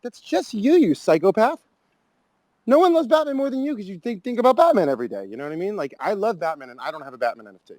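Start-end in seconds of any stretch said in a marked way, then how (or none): 0.0-1.6 mocking. Mocking brand owners. 2.7-5.2 mocking. Mocking brand owners.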